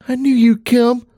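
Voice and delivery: put-on high, grateful child's voice; a small girl saying thanks; little girl voice. Child speaks to her mother.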